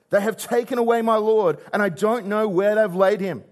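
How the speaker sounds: weeping Mary pleading with the angels; distraught Mary. Retold or imitated. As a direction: cry out your loss.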